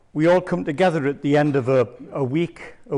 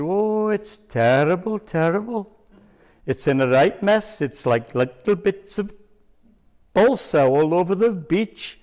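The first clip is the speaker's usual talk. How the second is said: prank Welsh coast guard voice, pitched higher; Welsh accent; 5 semitones higher than usual. Retold or imitated. Prank caller phoning his friend as a Welsh coast guard. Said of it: "please excuse the Welsh accent".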